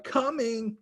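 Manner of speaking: put-on high child shout; shouting back. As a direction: yell back out the window.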